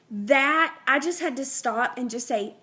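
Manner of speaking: ordinary talk, nothing marked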